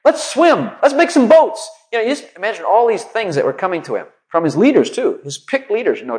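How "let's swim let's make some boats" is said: the people shouting much higher and louder; a crowd of panicked advice, hurried; hammed-up panicked yelling; frantic yelling. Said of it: "people yelling at him".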